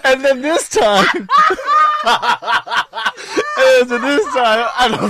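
high, loud and very slow, breaking into laughs; helpless laughter. Real, not acted. Trying to go on with his story, laughing too hard. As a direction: try to restart the sentence but keep cracking up.

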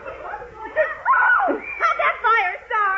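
high yelp breaking into laughter, slowly; squealing at the chaos; laughing shout. Acted. Betty caught in the spray.

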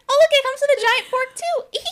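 high, fast fake-cheerful line; fake sitcom delivery. Cohost mocking the actors' fake laughter, in a high fast chirp. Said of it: "more fake laughter".

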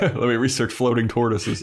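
chuckling while talking; laughing through words. Real, not acted. Joking with the co-host about Giles, laughing. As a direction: let the laugh spill into the line.

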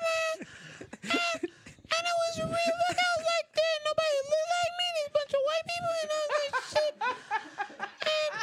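tiny falsetto for the whole story; highest voice. Pretend. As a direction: tell it all at the top of your range. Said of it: "in your highest voice".

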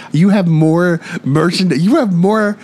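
high, drawn-out exasperated protest; mock outrage. Friend calling out a collectibles seller's denial, mock outrage.

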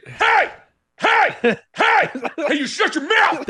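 high hammed-up shouts, breaking into laughter; mock rage outburst; wannabe Ferrell yell. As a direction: yell it like a fake Will Ferrell. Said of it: "such a wannabe Ferrell".

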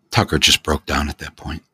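ordinary delivery

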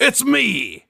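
high, hammy wrestler villain threat; Deitysaurus Flex. Acted. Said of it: "Deitysaurus Flex".

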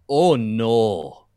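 broad imitation Scottish accent. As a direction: do a Scot reacting to a terrible shot. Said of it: "how to speak a Scottish accent".

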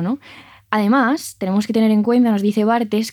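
usual speaking voice

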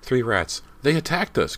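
put-on very high, loud excited kid; alarmed exclamation. A narrator's reading. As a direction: blurt it out in alarm. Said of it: "Cody added".

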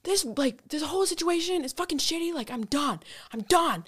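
high-pitched, fed-up complaint; retold rant winding down from a peak; exasperated venting. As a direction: vent it high, easing down by the end. Rest of retold blow-up.

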